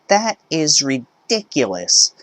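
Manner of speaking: indignant mother. Acted. Mother scoffing at the ID rule, high outraged voice. Entitled mother arguing at checkout.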